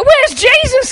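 high-pitched frantic call; mock panicked cry. Disciples in the storm looking for Jesus, acted panic. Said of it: "grabbing the ropes and screaming".

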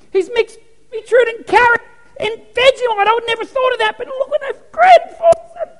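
high, loud excited cheering from the balconies; hammed-up cheering spectators, high and loud; cheering heavenly crowd. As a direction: shout them on like a game-show crowd. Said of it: "they're cheering them on".

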